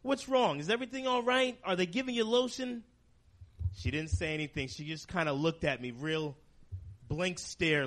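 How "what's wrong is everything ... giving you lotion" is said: higher, anxious questions to mom; worried son. Retold or imitated. Son asking his sick mother what's wrong, rising worry. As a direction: urgently check on her, pitch climbing. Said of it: "So I said".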